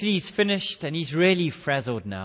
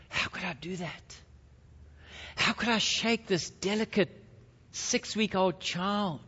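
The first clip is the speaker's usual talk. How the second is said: hushed, horrified self-reproach; 3 semitones higher, 4 dB quieter than usual. Acted. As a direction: ask it in soft, stunned disbelief. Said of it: "and he's horrified".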